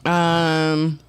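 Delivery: talking around a piece of candy; words with candy in the mouth; chewing while talking. Real, not acted. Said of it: "I'm chewing on a piece of candy".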